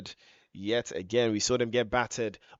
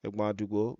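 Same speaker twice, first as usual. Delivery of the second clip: usual speaking voice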